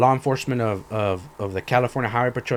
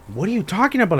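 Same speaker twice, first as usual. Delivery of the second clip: higher-pitched retold protest; exasperated disbelief; 7 semitones higher than usual. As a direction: protest as if baffled by him. Retells exasperation with shop man.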